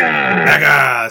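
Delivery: loud drawn-out yell of the name; shouted name. Real, not acted. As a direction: belt her name out. Echoing the shout-out.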